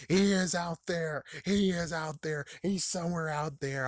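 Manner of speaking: high-pitched mock panic; hammed-up high, insistent alarm; freaking out. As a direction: wail it like someone losing it. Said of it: "him being freaking out".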